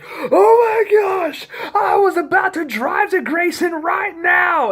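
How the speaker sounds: slow, very high, loud outcry of relief; exasperated shout. Retold or imitated. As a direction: yell it stretched out, mock-exasperated. Friend's relieved outburst after prank reveal.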